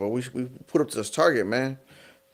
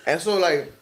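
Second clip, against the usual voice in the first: ordinary delivery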